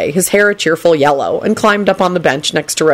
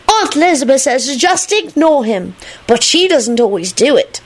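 put-on high, childish know-it-all; knowing little boy; 9 semitones higher than usual. A narrator's reading. Teddy passes on his aunt's advice, high knowing voice. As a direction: inform them in a high knowing voice. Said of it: "he added knowingly".

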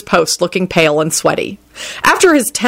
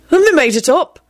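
higher-pitched smug declaration; 10 semitones higher than usual. A narrator's reading. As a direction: announce it, pleased with yourself. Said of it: "he declared smugly".

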